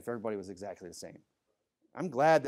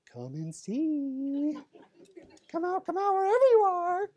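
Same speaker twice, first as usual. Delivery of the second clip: much higher, slow, eerie singsong; creepy voice; slower than usual. Pretend. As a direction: taunt like a creepy stalker. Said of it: "it's creepy".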